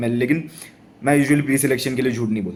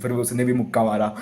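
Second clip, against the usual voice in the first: normal talking voice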